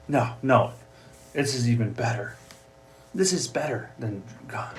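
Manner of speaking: ordinary talk, nothing marked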